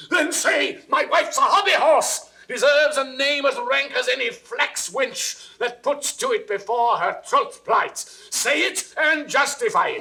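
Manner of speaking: high, loud raging demand; jealous king yelling; furious shout. Acted. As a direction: roar the accusation at him. King demands Camillo admit infidelity.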